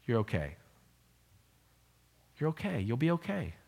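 soft, quiet, gentle you're okay; tender reassurance. Real, not acted. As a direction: comfort someone hurting, quietly.

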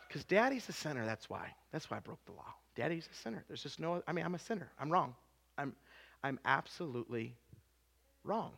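very low, hushed admission; subdued confession. Retold or imitated. Dad admitting to his child he sinned, subdued confession. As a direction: drop right down and own up softly.

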